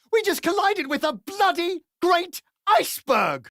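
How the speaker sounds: usual speaking voice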